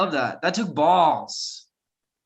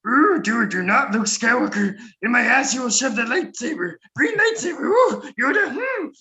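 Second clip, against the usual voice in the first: high, squeaky Yoda, climbing ever higher; campy Yoda impression; 5 semitones higher than usual. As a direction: camp it up as a flamboyant Yoda.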